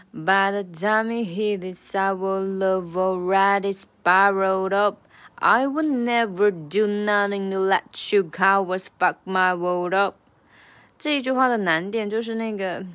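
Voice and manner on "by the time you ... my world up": half-speed practice rap; slow version. Shown as a demonstration. Tutor slows the lyric down for learners. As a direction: rap slowed right down, each word spelled out. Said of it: "好，慢速版".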